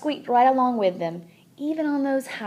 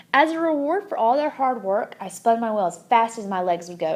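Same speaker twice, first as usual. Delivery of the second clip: ordinary delivery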